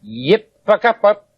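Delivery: garbled backwards-sounding syllables; reversed speech. Shown as a demonstration. Played backwards to the audience, garbled reversed speech.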